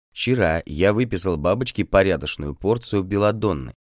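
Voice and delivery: plain reading voice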